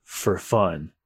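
low, soft, offhand menace; cool swordsman. Acted. Swordsman answering his victim's plea, cool.

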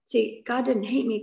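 usual speaking voice

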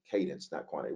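Cadence, not quantity said low and soft, Cadence leaned on; quiet restatement. Shown as a demonstration. Pastor drives the point home to the church, low and soft. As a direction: drop the voice and let "Cadence" land. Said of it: "so it is about cadence".

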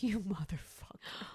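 whispered, low-volume retold cursing; hushed curse. Retelling her reaction to the prankster, hushed curse. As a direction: mutter it under your breath, no voice.

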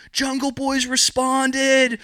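very high, slow, drawn-out excited blurt; breathless excitement. Retold or imitated. Grower announcing the buyer's reply, high excited blurt. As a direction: squeeze the news out giddily.

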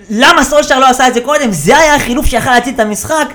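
high, loud mock outrage; indignant mimicked shout; imitated pundit rant. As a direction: protest like a commentator yelling at the coach. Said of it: "אז הוא אומר".

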